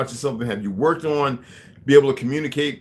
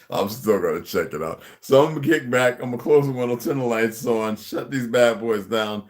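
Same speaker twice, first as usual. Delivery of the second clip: usual speaking voice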